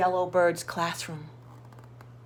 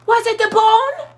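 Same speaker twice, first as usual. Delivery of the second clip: high, loud holler; dog hollering at the bird, much higher and louder; 14 semitones higher, 11 dB louder than usual. Acted. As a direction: holler it up the tree. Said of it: "Rocket hollered".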